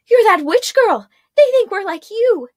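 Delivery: high, loud frightened shriek; a panicked scream at the stranger. Acted. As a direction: cry out in terror at the witch. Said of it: "one of them screamed".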